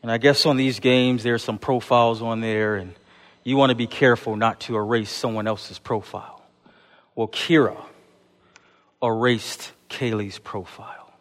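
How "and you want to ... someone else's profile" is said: normal talking voice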